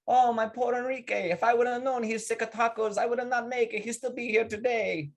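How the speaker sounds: high-pitched mock funeral lament; wailing Spanish widow. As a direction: mourn theatrically as the joke's Spanish wife.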